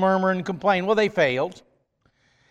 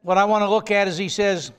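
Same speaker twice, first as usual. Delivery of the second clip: ordinary talk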